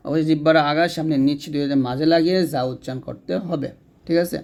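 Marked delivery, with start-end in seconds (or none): none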